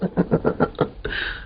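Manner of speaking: soft breathy chuckling, acted; contagious chuckle. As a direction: catch the other man's laugh. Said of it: "तुम क्यों हंसते हो?". (हल्की संक्रामक हँसी)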